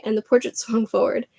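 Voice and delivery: ordinary delivery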